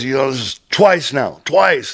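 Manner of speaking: high, indignant mock yell, climbing on the repeat; retold yelling. Roadmaster blaming the laborer for trouble, retold yelling. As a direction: imitate a boss scolding, pitch pushed up. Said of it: "And he's yelling at me".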